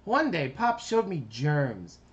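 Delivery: put-on high little kid storytelling voice; Carbonate voice. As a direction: savor the icky words like a kid. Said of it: "he says".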